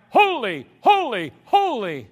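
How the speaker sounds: loud ringing proclamation; angels crying out. Acted. Preacher voicing angels crying out, loud and ringing. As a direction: call it out like angels in worship. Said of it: "heard those angels crying out".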